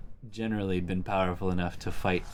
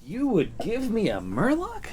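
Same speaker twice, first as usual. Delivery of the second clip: high, slowed, amused disbelief; pretend-touched astonishment; mock surprise; 13 semitones higher, slower than usual. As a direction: play up the surprise at the gift. Opponent's card offering a Murloc.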